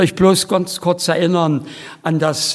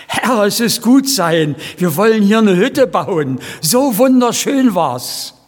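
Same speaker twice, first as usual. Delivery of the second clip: higher and louder, lightly voiced rapture; mild awed disciples; 5 semitones higher, 3 dB louder than usual. Disciples on the mountain exclaiming, lightly voiced elated higher. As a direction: voice the disciples' joy. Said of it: "wie den Jüngern mal auf dem Berg der Verklärung".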